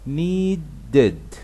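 needed drawn out very slowly, nee-ded clearly split; slowed down. Shown as a demonstration. Teacher slowing a word so students can copy it. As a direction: stretch needed syllable by syllable. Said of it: "ببطئها عشان تعرف تنطقها".

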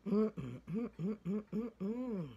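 loud mm-mm of delight; hungry sing-song humming; yummy hum. Acted. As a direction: hum it like the pancakes smell delicious. Smelling pancakes on the griddle.

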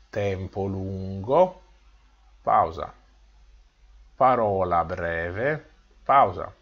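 long drawn words, clear pauses; slow with pauses. Shown as a demonstration. Teacher demonstrates timing and pauses to students, slow. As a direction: draw it out, then stop.

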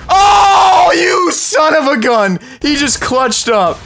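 loud, high, drawn-out cry of disbelief; shocked outcry. Real, not acted. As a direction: cry out as the rival escapes you. Outplayed by opponent in game.

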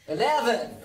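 laughing fake American accent; Irish-sounding American accent. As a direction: push the American accent, giggling. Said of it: "That sounds Irish".

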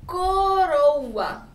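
"coroa" loud and drawn out, opening co held; coroa sílaba alongada. Shown as a demonstration. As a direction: stretch the first syllable of "coroa" loudly. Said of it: "olha o som que está fazendo". ("coroa" em voz forte, com a primeira sílaba alongada)

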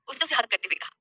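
ordinary delivery

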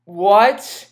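loud, high stunned disbelief; shocked. Real, not acted. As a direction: blurt out total shock. Shocked by the theory reveal.

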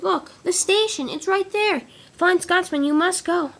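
high, pressing plea; urgent urging. Acted. Boss urges stalled engine onward.

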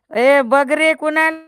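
high, loud, slow call; a playful loud call to Kunal. Real, not acted. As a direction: call to Kunal across the room. Calling to Kunal.

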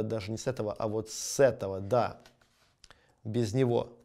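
usual speaking voice

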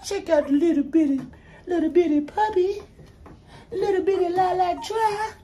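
very high cooing puppy voice. Real, not acted. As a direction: coo at the tiny pup in a squeaky high voice. Cooing over tiny puppy.

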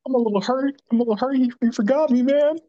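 high, hammed-up wounded voice; mock sulking, high and whiny; mock hurt. Friend pretends to be hurt.

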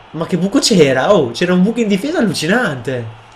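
worked-up gamer griping; incredulous complaint. Real, not acted. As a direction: protest in disbelief at the defending. Complains about conceded goal.

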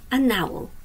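usual speaking voice

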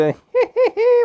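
squealing triumphant laugh; high gleeful laugh. Real, not acted. Player laughing as the opponent falls. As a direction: burst out laughing with glee.

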